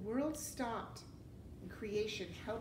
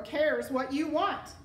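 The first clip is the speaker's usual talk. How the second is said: loud, slow, higher-pitched put-down; harsh snub; 5 semitones higher, 10 dB louder than usual. A narrator's reading. Rat snubbing the serving girl, loud and harsh. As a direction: hammer it home slowly and loudly.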